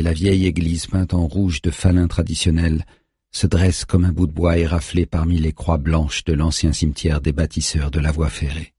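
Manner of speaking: ordinary delivery, nothing marked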